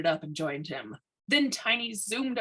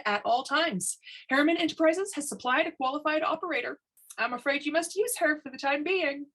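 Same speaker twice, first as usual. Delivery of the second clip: a brisk, cheerful official, pitched higher; higher, breezy bureaucrat voice; lightly voiced inspector; 10 semitones higher than usual. A narrator's reading. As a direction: play the chipper, smug chief inspector.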